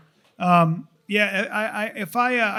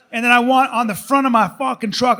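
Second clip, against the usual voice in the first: ordinary talk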